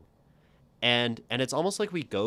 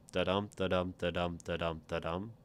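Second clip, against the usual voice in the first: Da-dum chanted with weak-strong stress; iambic beat; faster than usual. Shown as a demonstration. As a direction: tap out the iambic rhythm, da-DUM. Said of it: "a line in iambic pentameter".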